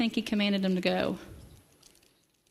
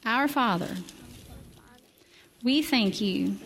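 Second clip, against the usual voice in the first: slow prayer with long pauses. Acted. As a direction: pray it slowly, pausing often. Leads the children in prayer.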